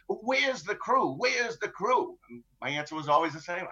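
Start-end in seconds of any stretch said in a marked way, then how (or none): 0.1-2.1 slow, higher insistent pirate question; pirates demanding. Retold or imitated. Pirates pressing the captain for his crew, slow and high. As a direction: drag it out, pressing him for an answer. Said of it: "that's what they constantly queried me".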